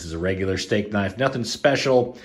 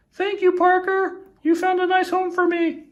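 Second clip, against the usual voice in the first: much higher squeaky voice of the record itself; 4 dB louder than usual. Pretend. The record thanks Parker in a much higher squeaky voice. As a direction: give the vinyl a tiny grateful voice.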